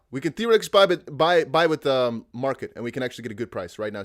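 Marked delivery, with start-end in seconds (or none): none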